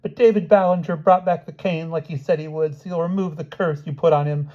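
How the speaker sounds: high, loud frightened plea; desperate high-pitched pleading; begging. A narrator's reading. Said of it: "she begged".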